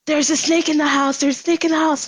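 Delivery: high, urgent panicked report; frantic snake warning; panicked telling. Retold or imitated. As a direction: blurt it out in panic to the gate man. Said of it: "I was, like, freaking out".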